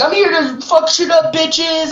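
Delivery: high, rowdy party shout-in; rowdy party entrance. Retold or imitated. Entering a location ready to party, high rowdy entrance. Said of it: "I'm like".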